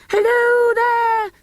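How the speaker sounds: high, slow, loud hail; shouted. Acted. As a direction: shout down from the tower. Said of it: "shouted Cyril from the church tower".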